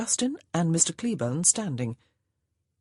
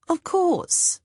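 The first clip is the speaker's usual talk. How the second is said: high bright chirp; sing-song; 13 semitones higher, 4 dB louder than usual. A narrator's reading. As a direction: sing it out sweetly. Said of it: "Monica sang out".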